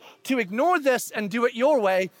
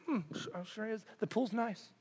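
sarcastic; 15 dB quieter than usual. Retold or imitated. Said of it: "hear it from the other people".

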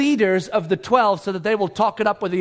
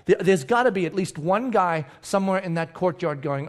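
usual speaking voice

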